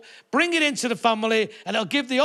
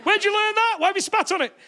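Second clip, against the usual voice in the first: much higher-pitched questioning; high, affronted comic voice; mock indignation; 10 semitones higher than usual. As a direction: squeak it out in mock disbelief. Acted indignant questioning about new trick.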